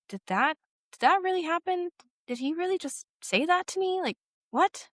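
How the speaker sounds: retold shock in a high voice; stunned disbelief. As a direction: act out reeling from what was said.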